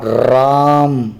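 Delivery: "राम" with the "रा" held very long and slow before the "म"; drawn-out ra. Shown as a demonstration. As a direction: stretch "रा" in "राम" like a chanted verse. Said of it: "रा को लंबा खींचा है".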